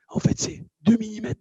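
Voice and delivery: usual speaking voice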